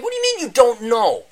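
can't believe what he's hearing; mock exasperated disbelief; incredulous. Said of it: "I'm like".

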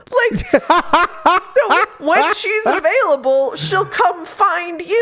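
high, laughing mock reply; laughing retort. Reader answering the coach through laughter. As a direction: laugh it off, then slow down. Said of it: "And I'm like".

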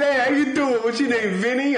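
put-on Brooklyn accent. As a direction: ham up a Brooklyn greeting. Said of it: "made fun of my Brooklyn accent".

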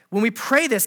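normal talking voice

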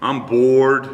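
lightly voiced bored kid. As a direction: whine it like a restless boy. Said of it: "And I'd say".

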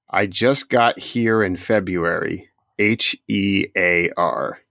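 slow, deliberate reading of a message. Retold or imitated. Host reads a coach's misspelled message aloud, slow and deliberate. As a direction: read it out slowly, spelling each letter. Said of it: "I'm actually gonna read it to you".

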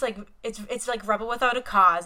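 ordinary talk

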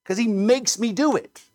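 mock the sinner pleading it's not his fault; whiny excuse-maker. Mimics a sinner blaming God.

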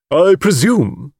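slow, imperious drawn-out words; deep-voiced matron. A narrator's reading. Mrs Caldecott challenging the guide, deep and imperious. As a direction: weigh each word like a formidable matron. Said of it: "in her deep voice".

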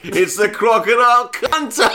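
high, loud show-opening announcement; hammed-up TV host. Host welcoming viewers to episode two, hammed-up announcement. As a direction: announce the title like an overexcited presenter. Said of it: "and he's just like".

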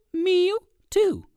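high mewing like a cat. Shown as a demonstration. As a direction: mew high like a cat bird. Little birds mewing.

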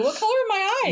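squeaky high, coy question; playful high voice. Retold or imitated. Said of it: "I used to say to you".

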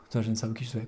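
ordinary delivery

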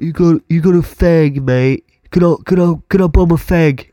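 British bloke cadging a smoke in Cockney; Cockney accent. Retold or imitated. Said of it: "Cockney one down".

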